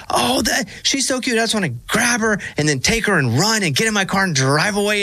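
lightly hammed-up cooing fan; gushing stranger. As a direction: coo over the baby, high up. Woman quoted at ball game.

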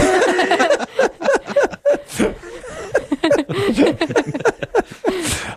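a long, high, real burst of laughter. Real, not acted. Host cracking up at a guess, real laughter.

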